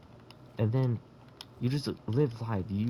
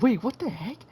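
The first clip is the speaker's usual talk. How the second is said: confused surprise; 11 semitones higher, 5 dB louder than usual. Retold or imitated. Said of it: "you're like".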